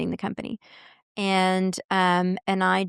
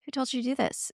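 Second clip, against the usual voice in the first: higher, much softer stunned question; amazed question; 5 semitones higher, 7 dB quieter than usual. Retold or imitated. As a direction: ask it in hushed disbelief. Her astonished reaction retold.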